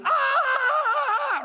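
long high wail of someone falling; mock falling scream. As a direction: pretend to plummet off a cliff, screaming. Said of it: "It'll be me going".

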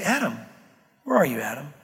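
put-on low, gentle calling of a child; fatherly, not mad. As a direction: call him tenderly, like a patient father. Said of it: "It was a fatherly thing".

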